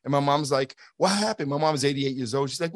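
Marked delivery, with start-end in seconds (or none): 1.0-1.5 higher-pitched, lightly voiced anxious mom; retold motherly concern, pitched up; worried mother imitation. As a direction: imitate an elderly mother's alarmed question. Imitates his worried elderly mother.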